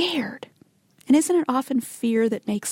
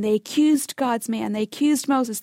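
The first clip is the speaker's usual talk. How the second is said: usual speaking voice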